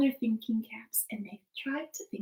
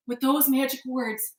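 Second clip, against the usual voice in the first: ordinary delivery, nothing marked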